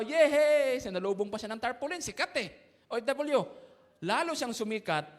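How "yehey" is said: loud happy cheer; excited cheer. Retold or imitated. Excited crowd cheering OFW.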